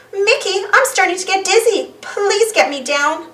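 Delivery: high, slowing plea; a lightly voiced dizzy duck; dizzy Daisy.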